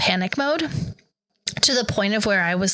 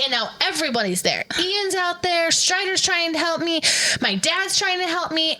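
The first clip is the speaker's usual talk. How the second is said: ordinary delivery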